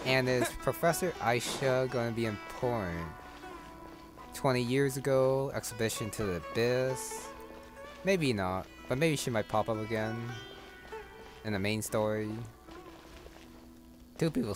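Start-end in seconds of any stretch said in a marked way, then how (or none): none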